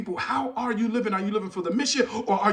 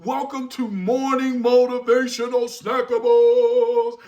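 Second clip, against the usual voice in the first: show intro; 4 semitones higher, 5 dB louder, slower than usual. Acted.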